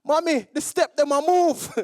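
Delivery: high, loud alarmed cry in Jamaican patois; scared child. Retold or imitated. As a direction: call to mummy, alarmed by a moving escalator. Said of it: "it was scary".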